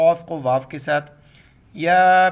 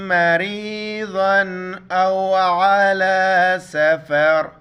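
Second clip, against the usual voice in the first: slow, chanted tilawat with drawn-out vowels; measured Quranic chant; Quran recitation; 4 dB louder, much slower than usual. Acted. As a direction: recite slowly for students to echo. Quran recitation for students to repeat.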